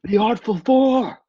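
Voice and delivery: high, slow battle cry; shouting. Acted. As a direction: yell it out like a war cry. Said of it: "Brago's just shouting".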